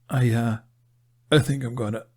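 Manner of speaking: queasy stuttering. A narrator's reading. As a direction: stammer it, about to be sick. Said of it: "Jack stuttered".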